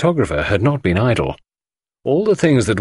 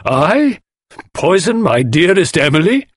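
high, indignant disbelief; shocked cry; 9 semitones higher, 4 dB louder than usual. A narrator's reading. Husband starts up at poisoning accusation, outraged cry. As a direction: protest the charge, aghast. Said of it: "cried Inglethorpe, starting up".

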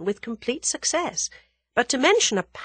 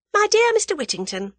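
high, louder, condescending address; poised young heroine; 5 dB louder than usual. A narrator's reading. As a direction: humour the angry man in a high voice. Voices the cool heroine.

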